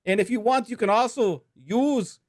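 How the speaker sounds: high-pitched, hammed-up Irish accent sponsor read. Podcast host reads the Saint Patrick's Day sponsor ad in a hammed-up Irish accent. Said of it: "we're gonna be reading this with an Irish accent".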